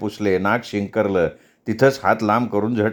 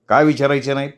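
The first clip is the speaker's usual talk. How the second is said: raised voice. A narrator's reading. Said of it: "भिवाचा आवाज चढला".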